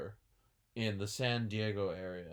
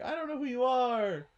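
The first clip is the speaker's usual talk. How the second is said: confused reply; 13 semitones higher, 6 dB louder than usual. Retold or imitated. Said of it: "you're like".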